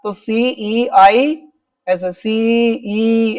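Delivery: सीईआई and सीई drawn out very slowly; stretched out. Shown as a demonstration. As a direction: stretch each letter of सीईआई like a dictation. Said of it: "ऐसे खींच के बोल रहे हैं आप".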